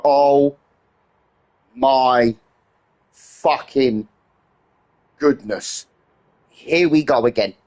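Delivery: long-suffering sigh of a man beaten by flies; weary exasperation. Retold or imitated. Ranting to viewers about flies, drawn-out weary despair. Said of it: "I'm like".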